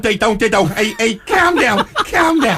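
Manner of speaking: high, drawn-out mock Scouse; bad Scouse impression. Radio host mocking a Scouse caller, bad Scouse accent. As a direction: put on a poor, over-pitched Scouse accent. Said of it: "That was more like a Norwegian".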